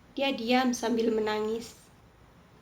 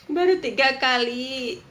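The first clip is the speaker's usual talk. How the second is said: mocking repeat; 6 dB louder, slower than usual.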